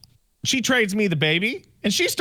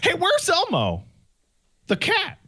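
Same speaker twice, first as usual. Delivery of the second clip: quoting wife; 12 semitones higher than usual. Retold or imitated. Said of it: "and she's like".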